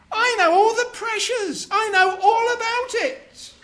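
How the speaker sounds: high, emphatic all-knowing wife. Acted. Wife teasing husband about pressure, high and emphatic. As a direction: lay it on knowingly.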